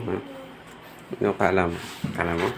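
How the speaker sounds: ordinary talk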